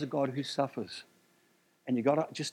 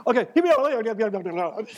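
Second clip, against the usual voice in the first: high, loud gabbled burst with a laugh; comic rushing; 7 semitones higher, 6 dB louder, slower than usual. Pretend. Preacher joking about five minutes left, comic rushing. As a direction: play at racing through it, voice raised and laughing. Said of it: "Speaking as fast as I can here".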